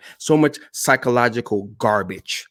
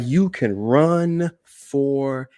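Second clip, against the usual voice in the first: slow, stretched "run forever"; "forever" broken in two and held long; drawn-out emphasis; 3 semitones higher, slower than usual. Shown as a demonstration. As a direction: stretch "run", "for" and "ever" as if it never ends. Repeat stretched to sound endless.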